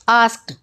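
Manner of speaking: आस्क्ड ending in a crisp T, "askt"; one syllable, आस्क्ड as "askt". Shown as a demonstration. Teacher showing written D spoken as T, आस्क्ड said "askt". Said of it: "लिखा डी हुआ है लेकिन बोला टी जाता है".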